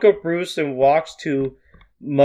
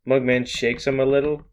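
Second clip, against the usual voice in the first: ordinary delivery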